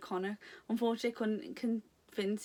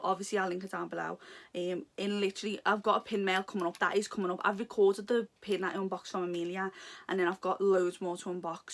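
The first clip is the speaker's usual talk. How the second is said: ordinary delivery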